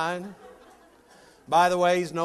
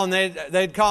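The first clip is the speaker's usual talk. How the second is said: ordinary talk, nothing marked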